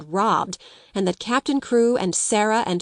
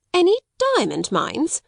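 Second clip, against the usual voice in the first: high, startled disbelief; shocked exclamation; 10 semitones higher than usual. Acted. Headmistress blurts out her disbelief, high-pitched. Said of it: "ejaculated Miss Minchin".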